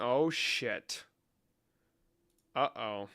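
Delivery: usual speaking voice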